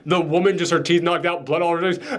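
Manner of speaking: ordinary talk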